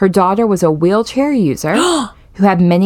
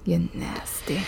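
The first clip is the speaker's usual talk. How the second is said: whispering; 14 dB quieter, slower than usual. Acted. Echo whisper.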